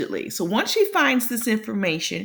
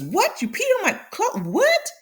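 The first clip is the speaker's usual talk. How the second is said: shocked disbelief; 11 semitones higher than usual. Retold or imitated. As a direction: voice shooting much higher and fast in mock shock. Mikey shocked she peed on clothes.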